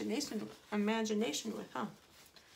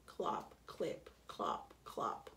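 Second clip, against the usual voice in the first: soft, low clopping hoofbeats; hoofbeat imitation; 14 semitones lower, 6 dB quieter, much slower than usual. A narrator's reading. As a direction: click out each hoof step softly and separately. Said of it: "Horses' hoofs".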